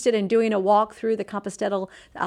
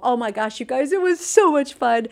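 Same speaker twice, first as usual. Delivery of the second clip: bursting with excitement, higher and louder; excited; 5 semitones higher, 7 dB louder than usual. Real, not acted. Mother telling viewers about the Compostela walk, gushing and excited. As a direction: gush about the trip.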